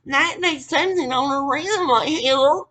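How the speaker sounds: high, loud, slow put-on voice; mocking mimicry. Mimicking those pushing work for Nat's resume, high mocking drawl.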